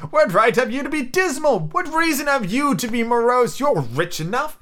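high, lilting retort; gaily. A narrator's reading. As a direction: throw it back merrily, pitched high. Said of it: "returned his nephew gaily".

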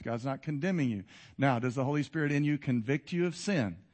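ordinary talk

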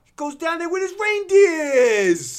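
put-on high, loud child voice, very drawn out; imitated insistent kid. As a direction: play a kid insisting at the top of their voice. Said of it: "one kid's like".

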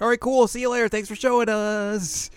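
very high, cheery send-off; mock friendly. Group seeing a girl off, high mock-friendly voice. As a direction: sing out a fake breezy goodbye.

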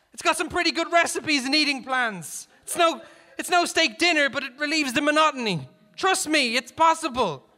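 high, loud encouragement across a distance; calling out. A narrator's reading. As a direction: shout advice to a fellow dieter. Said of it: "Garrison called".

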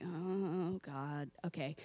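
low, hushed reluctant sigh of words; soft, sinking, uneasy aside; quiet dread. Retold or imitated. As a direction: mutter it uneasily to yourself. Her dread on seeing the kitten.

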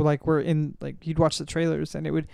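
ordinary talk

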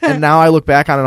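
ordinary delivery

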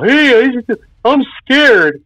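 high, whiny mock scared voice. Kid waking family at night, hammed-up high frightened whine. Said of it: "be all".

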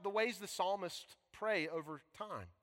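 normal talking voice

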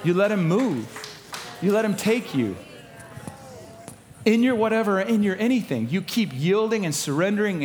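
high, loud preacher delivery; full-force preaching. Real, not acted. Preacher urging the church to let the Spirit lead, full-force preaching. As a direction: drive it home to the congregation, loud and high.

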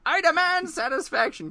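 high, loud croaking demand for satisfaction; croaking, indignant demand; croaked demand. Acted. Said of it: "croaked Grover".

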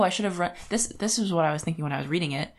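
ordinary talk

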